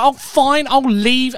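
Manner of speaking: high, loud sulky exit line; dramatic huff. Retold or imitated. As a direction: storm off in a huff. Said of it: "really dramatically put all your stuff".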